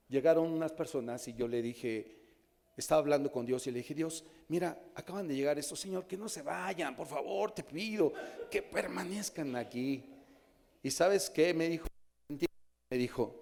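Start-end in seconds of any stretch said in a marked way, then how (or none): 3.9-10.0 pleading prayer. Retold or imitated. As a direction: beg God gently to let them stay. Pastor repite su oración a Dios. (oración suplicante, más suave y aguda)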